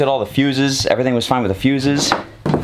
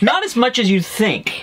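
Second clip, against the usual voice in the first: laughing; 12 semitones higher than usual. Real, not acted. Laughing correction of parenting claim.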